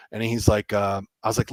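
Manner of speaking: normal talking voice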